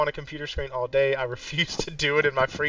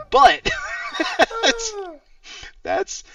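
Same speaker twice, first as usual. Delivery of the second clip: laughing; 5 dB louder, much slower than usual. Real, not acted. Laughing at own story.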